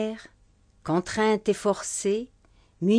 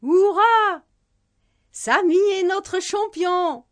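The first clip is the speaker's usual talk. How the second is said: much higher, louder cheer, then slow chant; crowd cheering; 10 semitones higher, 3 dB louder, slower than usual. Acted. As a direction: cheer like a whole crowd at the finish line. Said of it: "cria la foule".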